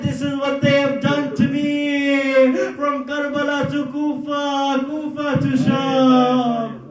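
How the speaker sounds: high, loud grieving preacher's recital; mournful lament recital. Acted. Preacher recounting Imam Sajjad's suffering to mourners, high loud lament. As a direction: wail the tragedy to the mourners at full force.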